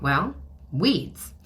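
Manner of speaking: ordinary talk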